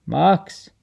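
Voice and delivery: calling Max's name. Acted. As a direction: call the dog's name into the bush. Calling the missing dog.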